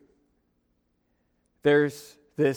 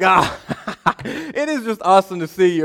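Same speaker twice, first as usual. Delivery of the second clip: forced laugh through the greeting, a bit higher; faking it; 3 semitones higher than usual. Retold or imitated. Bluffing the woman whose name he forgot, fake laughing. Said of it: "trying to fake it till you make it".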